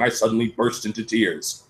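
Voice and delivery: usual speaking voice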